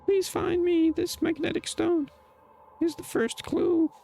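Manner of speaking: high, echoing ghostly plea; a trapped ghost begging for rescue, high and eerie; haunting voice. Acted. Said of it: "a ghostly voice coming from behind you".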